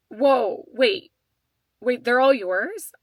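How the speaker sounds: retold surprised gasp, slowing on the question; surprised cashier. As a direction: act out the cashier's double take. Cashier surprised by four kids.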